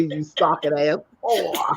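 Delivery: slow, drawn-out mocking insult; slow taunt. Insulting the stalker in chat with a slow mocking drawl. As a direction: stretch it out to taunt.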